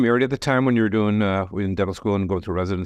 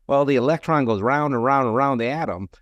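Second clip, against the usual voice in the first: mock Southern drawl; 4 semitones higher than usual. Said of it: "a comedian who talked about".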